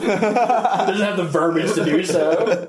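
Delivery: slow words through laughter; laughing. Real, not acted. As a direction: draw it out, laughing.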